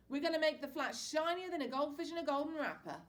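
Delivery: tossing treats, jolly father; Dad. A narrator's reading. Dad to his boys, loud cheery father voice.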